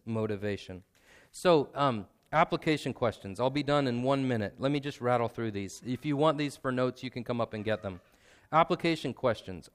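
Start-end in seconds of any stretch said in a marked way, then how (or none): none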